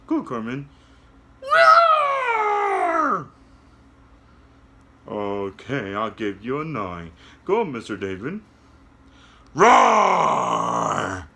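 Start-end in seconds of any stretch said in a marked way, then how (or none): 1.4-3.3 very high, loud puppet roar; a squeaky little lion roar. Acted. Carmen puppet roars for the class, very high and loud. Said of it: "you do a loud roar". 9.6-11.3 a big lion roar from the chest; loud roar. Acted. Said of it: "you do a loud roar".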